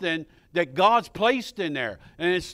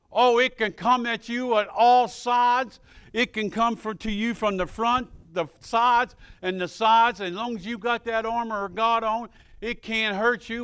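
preaching; 5 semitones higher, 5 dB louder than usual. Acted. Preacher assures the flock of God's armor, raised voice. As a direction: press the point home.

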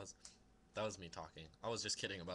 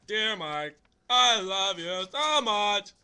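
loud, high, drawn-out mock love letter; hammed-up, half-sung letter reading; funny sing-song reading; 5 semitones higher, 12 dB louder than usual. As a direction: belt it out, stretching the words. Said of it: "likes my singing. I mean, reading".

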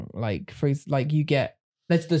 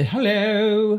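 posh English; 7 semitones higher than usual. Retold or imitated. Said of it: "then you get the".

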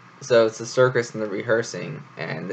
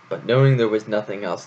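plain reading voice

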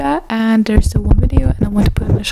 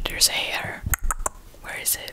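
whispering; 14 dB quieter than usual. Real, not acted. Whispered asides.

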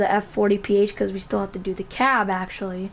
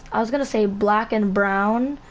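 ordinary talk, nothing marked

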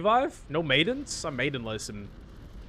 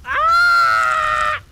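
scream; 11 dB louder than usual. Real, not acted. Sudden scare in game.

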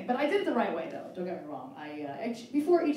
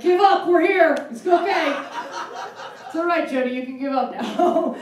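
high, loud, laughing mock consolation; cats' voice; 6 semitones higher, 8 dB louder than usual. Cats bursting in telling Jody to give up, high and loud. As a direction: pack of cats gently telling her to give up. Cats speaking to single woman.

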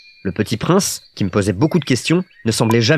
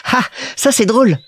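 put-on high, amused little-boy voice; amused Petit Prince; 11 semitones higher than usual. A narrator's reading. Little prince finds the pilot's fall funny, high and amused. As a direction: exclaim like a tickled small child.

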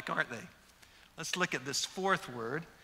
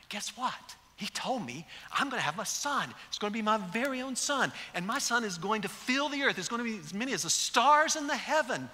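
hushed excitement; 5 semitones higher than usual. Pretend. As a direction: share the secret news, growing giddy. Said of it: "I'm wondering if Abraham came back".

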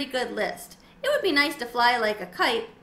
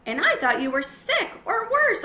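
higher, louder squeak; squeaky reply; 11 semitones higher, 6 dB louder than usual. A narrator's reading. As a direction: squeak back in relief. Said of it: "I squeaked back".

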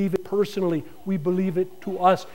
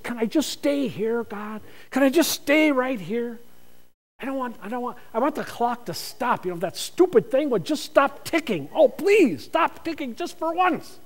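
pleading with God; 4 semitones higher than usual. Retold or imitated. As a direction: beg the clock to stop, gently. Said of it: "You would like to just".

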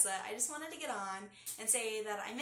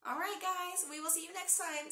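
ordinary delivery

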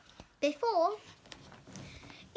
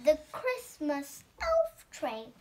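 ordinary delivery, nothing marked

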